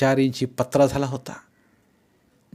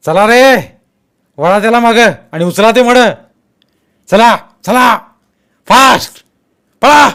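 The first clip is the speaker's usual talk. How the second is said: high, very loud urgent commands; shouted orders; 10 semitones higher, 13 dB louder than usual. A narrator's reading. Gang man orders his men to hurry off, shouting. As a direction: drive the men on with barked orders. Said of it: "त्यांच्या लोकांना आदेश दिला".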